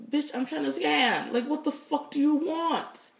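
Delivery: high-pitched exasperated outburst, last words drawn out; exasperated retort. Retold or imitated. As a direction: vent the comeback you held back, pitch climbing. Acted exasperated reply to supervisor.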